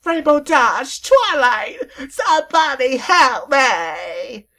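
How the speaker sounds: very high, very loud, slow shouting; frantic high cries; desperate calls for help. Acted. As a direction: scream for friends to save you.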